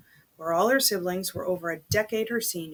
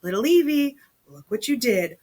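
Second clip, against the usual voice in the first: a hint of a proud father, "Evie" lifted higher and louder; doting daddy; 4 semitones higher, 6 dB louder than usual. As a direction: lightly voice the cheerful dad praising his girl. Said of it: "her daddy said".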